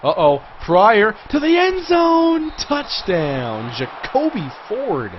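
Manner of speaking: high, loud, drawn-out play-by-play cry; excited touchdown call. Real, not acted. Gamer calls his own touchdown pass, excited shout. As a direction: build to a shouted call, then fall away.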